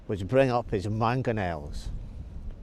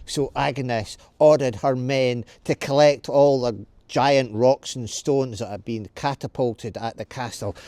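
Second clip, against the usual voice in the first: usual speaking voice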